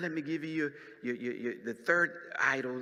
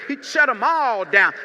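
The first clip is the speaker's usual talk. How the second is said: loud preaching; 7 semitones higher, 14 dB louder than usual. Acted. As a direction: SHUT proclaimed loud and punched. Preaching, loud first take.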